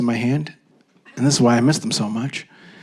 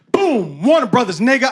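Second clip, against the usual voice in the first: higher, triumphant call of the name; aggressive announcement; 9 semitones higher than usual. Retold or imitated. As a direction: slam it down like a winning card. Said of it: "I didn't have this voice".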